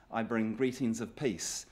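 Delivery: normal talking voice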